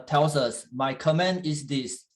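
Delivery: ordinary delivery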